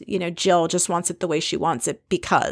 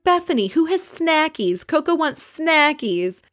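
much higher, drawn-out "snackies"; baby talk; 8 semitones higher than usual. Retold or imitated. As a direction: coo like talking about a pet. Ramona's baby talk for dog.

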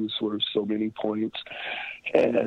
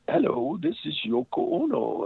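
put-on a light Japanese-accented [name] voice, pitched much higher; imitating [name]; 10 semitones higher than usual. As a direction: mimic [name]'s accented, high phone greeting. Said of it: "I can't do Yoko's accent".

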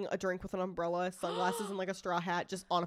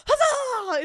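big high cheer, HUZZAH shouted out; excited huzzah; 14 semitones higher, 8 dB louder than usual. Shown as a demonstration. Friend shows the version she doesn't mean, cheering loudly. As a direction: throw "Huzzah!" out like a rallying cry. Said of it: "It's not even like".

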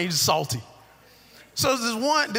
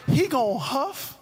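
preaching cadence; 6 semitones higher, slower than usual. Acted. Preaching the lesson.

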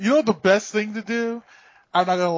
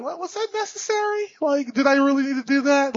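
incredulous; 7 semitones higher than usual. Retold or imitated. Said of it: "you're always like".

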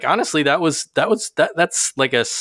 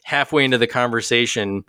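usual speaking voice

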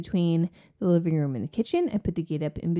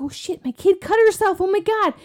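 high, louder worried outburst; alarmed; 10 semitones higher, 5 dB louder than usual. Retold or imitated. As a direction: blurt it in sudden fear. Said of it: "And I was like".

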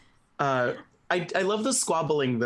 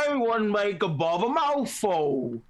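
slow, higher troll with bulging cheeks; 7 semitones higher, slower than usual. Acted. Podcaster acting out the troll scene, slow mouth-stuffed troll voice. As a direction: mush the words as if cheeks are full. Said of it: "acting like you have tennis balls in your cheeks".

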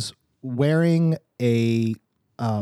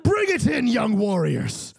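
booming coach; 7 semitones higher, slower than usual. Acted. Coach rallying team.

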